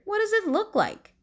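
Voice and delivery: high, irritated retort; grumpy bird snapping at Raccoon; snapped. A narrator's reading. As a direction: throw the question back sharply. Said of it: "snapped Bird".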